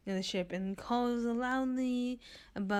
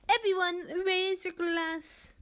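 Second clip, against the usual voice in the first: calling out; 11 semitones higher, 5 dB louder than usual. Acted. Renza calls for a toast.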